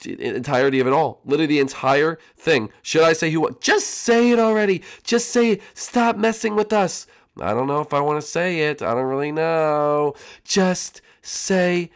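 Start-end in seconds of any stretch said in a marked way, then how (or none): 3.6-7.1 impatient puppet voice. Acted. Contestants impatient with host.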